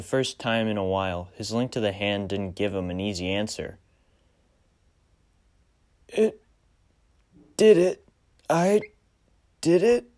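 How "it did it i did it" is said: high, loud, halting broken words; forcing each word out painfully; struggling with the words. Acted. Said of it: "He struggled with the words".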